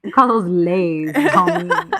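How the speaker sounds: laughing, then high on homie; laughing mock retort. Friend jokes about crisps with a laughing retort. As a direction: tease through a laugh.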